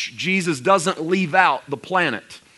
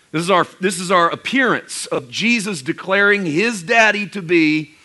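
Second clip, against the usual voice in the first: usual speaking voice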